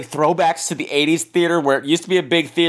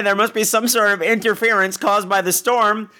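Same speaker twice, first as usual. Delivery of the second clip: normal talking voice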